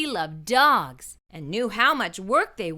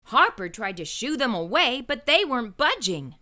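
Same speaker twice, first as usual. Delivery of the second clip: ordinary delivery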